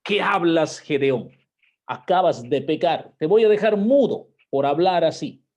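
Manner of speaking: loud, high hammed-up rebuke; mock scolding. God pretending to scold Gideon, hammed-up loud rebuke. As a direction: play a stern God threatening to strike him mute. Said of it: "¿Acaso el Señor le dice".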